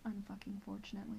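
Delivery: fast, unvoiced whisper of annoyance; grudging whisper. Real, not acted. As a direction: hiss it under your breath, fed up. Grudging answer about seeing him.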